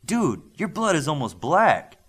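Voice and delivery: loud, high startled blurt; mildly shocked young guy. A narrator's reading. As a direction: it just bursts out of him. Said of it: "Olympian blurted".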